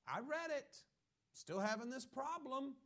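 high, loud put-on complaining voice; mock whine. Preacher mimics a frustrated reader, high and whiny. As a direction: whine about being stuck.

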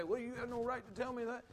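high mock indignant listener. Preacher mimics an offended hearer objecting. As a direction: mimic a huffy sinner objecting.

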